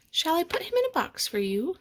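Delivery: high, polite shop assistant; offering helpfully at the counter; lightly voiced sales lady. A narrator's reading. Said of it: "the sales lady asked".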